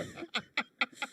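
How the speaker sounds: high, sudden burst of laughter. Real, not acted. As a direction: crack up mid-sentence. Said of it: "I couldn't say that with a straight face".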